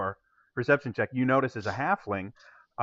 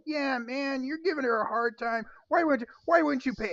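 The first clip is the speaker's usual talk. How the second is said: higher, mild heckler voice; another crowd member; 12 semitones higher than usual. Acted. Crowd member scolds the man, lightly voiced heckler. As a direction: chide the cheapskate from the crowd. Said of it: "crowd are actually sitting there going".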